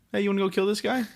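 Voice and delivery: high, put-on chirpy asker; mock casual invitation. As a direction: pitch it up like a silly proposal. Acts out absurd example question.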